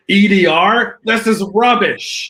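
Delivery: high, loud, slow scathing chef verdict; Gordon Ramsay. Pretend. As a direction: sneer it out like a furious TV chef. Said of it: "Imagine Gordon Ramsay walking in".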